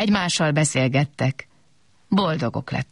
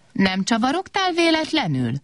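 stern; 8 semitones higher than usual. A narrator's reading. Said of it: "kérdezte szigorúan Kobak".

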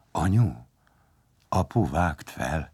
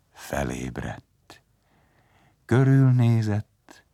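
usual speaking voice

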